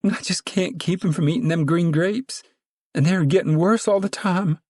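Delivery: high meek whining; meek whine. A narrator's reading. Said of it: "The meek voice whined".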